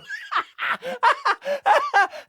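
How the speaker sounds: high maniacal cackle; mad cackle. Acted. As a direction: throw back a mad scientist's cackle. Villain cackles in game line.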